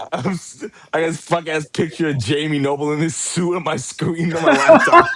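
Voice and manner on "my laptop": high, loud burst of laughing; loud laughter. Real, not acted. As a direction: crack up at the picture. Laughing at picture.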